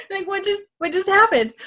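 high-pitched, baffled little voice; mock bewilderment. Acting out dazed lost-kid reaction.

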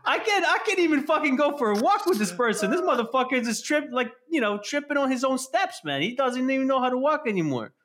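dog complaining about its lazy owner, high and mocking; voicing his dog. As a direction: squeak it out like a fed-up dog. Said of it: "My dog is like".

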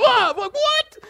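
mock startled yelp; startled waking. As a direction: jolt awake confused. Boy waking confused.

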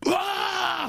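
high, long mock shout of frustration; a high drawn-out yelp like the drummer; imitated angry yell. Friend imitating drummer's angry yelp between songs. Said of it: "you'll hear Lenny go".

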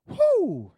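sudden loud high whoop of delight. Real, not acted. Preacher whooping to the congregation, loud and high. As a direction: let out a joyful whoop. Preacher exclaims over Jesus sleeping.

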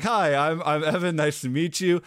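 upbeat self-introduction with the name said straight away; friendly greeting. Shown as a demonstration. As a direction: say it smoothly and brightly this time. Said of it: "Uh, no, like".

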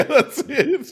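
laughing through the words; high giggly laugh while speaking. Real, not acted. Laughing at a shared joke.

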